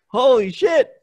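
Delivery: high, loud astonished exclamation; stunned. Retold or imitated. Hearing a stranger recommend his own show, high loud shock. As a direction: blurt out in disbelief.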